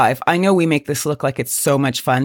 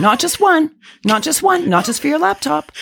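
excited sales pitch; 11 semitones higher than usual. Acted.

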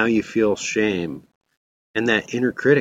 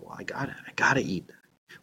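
fast, breathless whisper of giving in; whispered excuse; 11 dB quieter, faster than usual. Retold or imitated. Preacher voicing his own weak self, in a fast whisper. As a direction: hiss it out quickly like a guilty confession. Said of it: "And I go".

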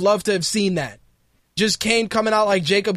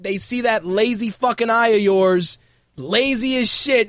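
normal talking voice

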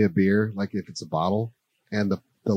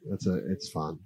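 ordinary delivery

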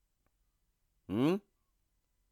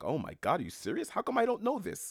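retold surprise; 12 semitones higher than usual. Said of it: "And I was like".

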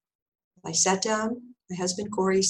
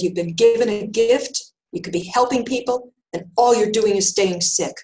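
imitating a scolding healer, raised voice; retold yell; 2 semitones higher, 6 dB louder than usual. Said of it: "And then she yelled".

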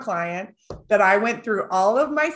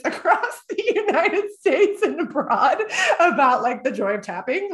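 laughing; 7 semitones higher, 3 dB louder than usual. Real, not acted. Laughs at her own irony.

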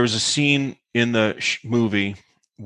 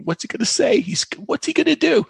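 much higher, urgent aside; retold giddy anticipation. Actress elbows Tommy while eavesdropping on the star, giddy. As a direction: squeal it like a nosy conspirator.

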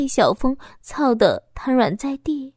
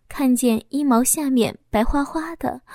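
usual speaking voice

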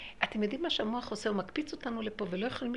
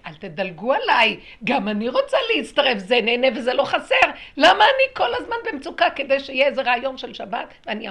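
loud, raised-pitch outcry; impassioned protest; 5 semitones higher, 14 dB louder than usual. Acted. Voice begging to join in and not be skipped, loud protest. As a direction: plead loudly not to be left out.

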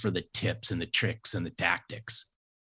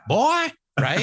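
put-on drawn-out Southern "Boy," pitched way up; Southern accent; 7 dB louder than usual. Mother-in-law calling him boy, in a high Southern drawl. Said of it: "but with a Southern accent".

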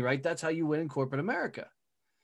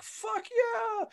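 much higher, cheering fans; mock crowd cheer. Speaker imitates his fans cheering at the conference, mock whoop.

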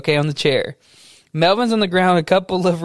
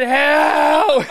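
very high, long-held cry; stretched, high-pitched mock plea; mock scream for help; 6 semitones higher than usual. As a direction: wail it out, hammed up. Keeps acting cry for help.

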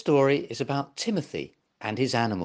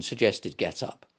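plain reading voice

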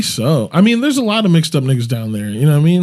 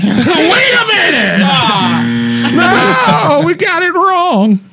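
high, slow cry of realisation; mock alarmed cop; 13 semitones higher, much slower than usual. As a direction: stretch it out high in comic alarm.

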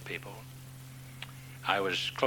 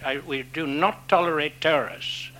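mock perfect English; 7 semitones higher, 7 dB louder than usual. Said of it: "he said in perfect English".

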